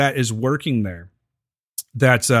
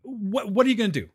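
mock bright, higher sarcastic jab; 6 semitones higher than usual. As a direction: throw it back with a higher, sarcastic lilt. Sarcastic retort to mechanic.

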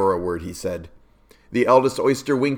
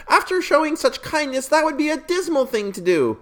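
squeaky little oysters. Acted. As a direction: squeal it like a small shellfish. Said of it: "the oysters cried".

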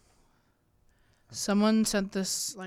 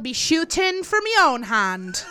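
put-on bold Scottish accent; Merida; 2 semitones higher than usual. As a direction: declare it as a Scottish heroine. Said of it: "that's Scottish".

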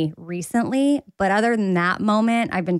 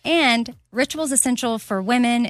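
upbeat sponsor read; bright ad-read delivery; 5 semitones higher than usual. Acted. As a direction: sell it like a podcast ad. Ad read by host.

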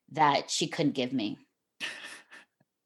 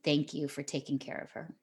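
softer, hushed retold thank-you; tender thanks; 2 semitones lower, 9 dB quieter than usual. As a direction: murmur it gently, full of gratitude. Birth mother thanks adoptive father.

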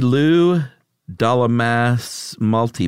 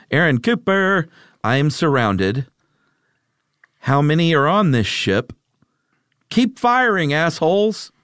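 desperate space marine; 7 semitones higher than usual. Acted. Acted haiku, trapped space marine.

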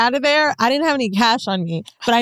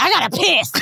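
imitating Mandy; 5 semitones higher than usual. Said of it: "Mandy saying that".

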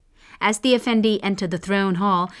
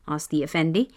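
usual speaking voice

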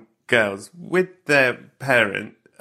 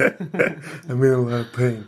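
slow mumbled actor; much slower than usual. Retold or imitated. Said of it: "severe dental problems".

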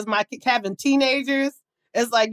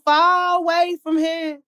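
slow, higher D C crowd singalong; D C crowd accent; 7 semitones higher, slower than usual. Retold or imitated. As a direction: drag it out like a hometown D C crowd. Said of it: "I was cracking up".

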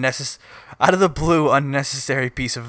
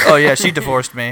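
offhand dad voice; 7 dB louder than usual. Pretend. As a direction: toss it off like a bored father. Voicing the dad.

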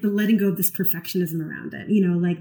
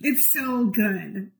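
slow, drawn-out enthusiasm; gushing praise; 2 semitones higher, much slower than usual. Real, not acted. Guest recommends Shrill to her co-host, gushing. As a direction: savour the praise, stretching the words out. Gushing over a show they share.

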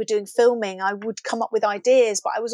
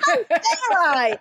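mock outrage; 7 semitones higher, slower than usual. Mock self-scolding after laugh.

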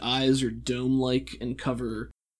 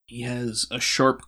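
normal talking voice

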